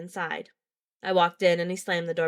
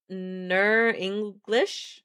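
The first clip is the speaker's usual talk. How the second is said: slow drawl; much slower than usual. Acted. Strat's interruption, drawn out.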